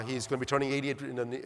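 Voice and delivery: ordinary talk, nothing marked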